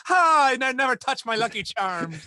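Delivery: put-on failed Boston accent turned leprechaun; super-high leprechaun. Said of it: "I always go super high in the register".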